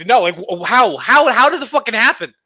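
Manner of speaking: building exasperation. Real, not acted. Friend baffled by the giraffe ban, building to a loud yell. Ranting about giraffe law.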